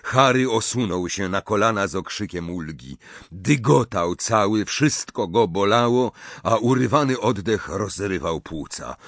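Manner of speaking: ordinary delivery, nothing marked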